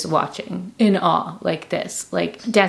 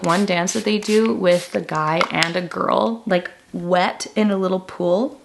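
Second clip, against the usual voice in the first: normal talking voice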